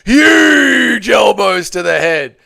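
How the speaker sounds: loud, excited ringside call; fight commentator impression. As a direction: yell it like a UFC commentator. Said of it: "I do a few good impressions".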